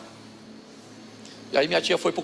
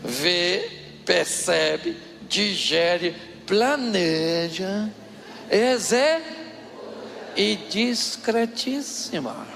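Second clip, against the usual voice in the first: drawn-out dramatic list; much slower than usual. Real, not acted.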